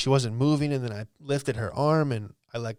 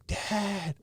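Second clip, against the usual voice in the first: scared call; 8 semitones higher, 5 dB quieter than usual. Retold or imitated. Calling down about unresponsive mom.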